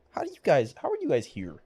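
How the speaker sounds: usual speaking voice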